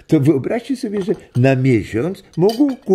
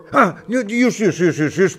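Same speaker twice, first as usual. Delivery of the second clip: laughing, pitched up, urging everyone along; laughing hurry; 6 semitones higher than usual. Acted. Grandpa laughing as he hurries the family off. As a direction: hurry them out with a giggle.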